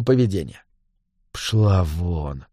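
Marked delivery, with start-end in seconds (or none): 1.3-2.4 very low, soft menace; through gritted teeth. A narrator's reading. Gang boss dismissing woman, through gritted teeth. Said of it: "сквозь зубы процедил Кривой".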